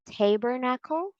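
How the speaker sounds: tabernacle as TAY-ber-nackle, rising; wrong long A. Shown as a demonstration. Teacher offers possible readings, long A. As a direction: ask tabernacle with "tay" up front. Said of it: "How do you read this?".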